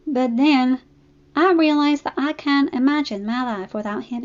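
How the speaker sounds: lightly voiced, sincere country mare; Applejack's voice. Applejack telling Fluttershy about Spike, sincere. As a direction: gently share a confession.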